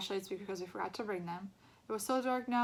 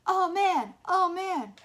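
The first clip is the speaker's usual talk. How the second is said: mock horror; 11 semitones higher, 13 dB louder, much slower than usual. A narrator's reading. Bully mock shock.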